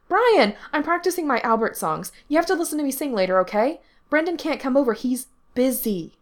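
put-on high, loud, self-important kid; excitable, demanding boy. A narrator's reading. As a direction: announce it urgently to the grown-up. Excitable boy makes demands of Brian.

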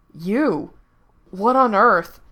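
loud, shocked exclamation; startled outburst. A narrator's reading. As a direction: jump back in disbelief. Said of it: "looked at the fox in surprise".

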